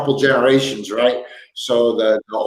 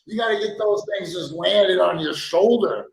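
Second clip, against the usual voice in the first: mock-superior correction, pitched up; know-it-all scolding; 8 semitones higher than usual. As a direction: talk down to her like an expert. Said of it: "I was like".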